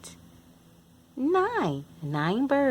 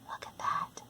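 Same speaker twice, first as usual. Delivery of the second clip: awed whisper; 14 dB quieter than usual. Acted.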